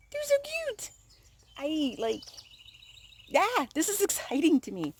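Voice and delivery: high-pitched gushing; excited. Real, not acted. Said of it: "Why is she freaking out?".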